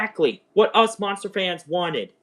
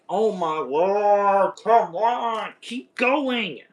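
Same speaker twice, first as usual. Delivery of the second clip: slow, drawn-out mock groan at the screen; frustrated pleading; 5 semitones higher, much slower than usual. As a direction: stretch it out, begging the show to go on. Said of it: "And I was like".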